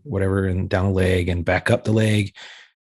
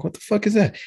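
sudden high disgusted yelp; startled cry of disgust; shocked outburst; 11 semitones higher than usual. Retold or imitated. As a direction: recoil at the smell, pitch jumping up. Disgusted cry during search.

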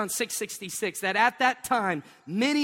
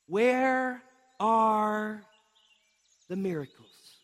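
slow dramatic question; much slower than usual. Retold or imitated. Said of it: "Notice their question".

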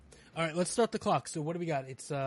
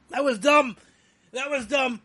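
loud, high pained regret; agonized, raised voice; real pain; 8 semitones higher, 10 dB louder than usual. Real, not acted. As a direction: cry out regret through the burn. Regret while in burning pain.

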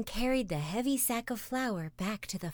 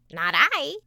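loud, higher quack of a duck; noisy duck quacking; 7 semitones higher, 9 dB louder than usual. Acted. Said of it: "quacked the noisy yellow duck".

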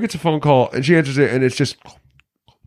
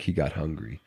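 low, much softer deadpan line; deadpan Loomis; 10 semitones lower, 13 dB quieter than usual. Retold or imitated. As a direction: drop it low and quiet like a grim doctor. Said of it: "And Loomis is just like".

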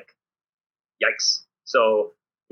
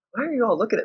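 much higher baffled question; mock baffled; 12 semitones higher, faster than usual. Coach to American players staring, mock baffled higher question. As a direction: play the confused foreign coach. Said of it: "I say some stuff and I'm like".

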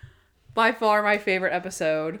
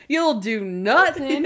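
slow, higher mock-dramatic refusal; hammed-up defiance; 4 semitones higher, 4 dB louder, slower than usual. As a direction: draw it out like overdone stage acting. Acted threat in retold play.

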